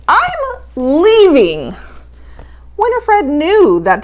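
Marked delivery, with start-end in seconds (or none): 0.1-1.8 monster shout. Acted. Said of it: "a big monster shouted".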